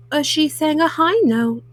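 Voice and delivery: usual speaking voice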